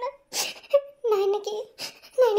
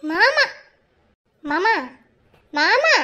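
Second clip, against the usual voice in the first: calling out; 4 dB louder, much slower than usual. Acted. Wife calling distracted husband.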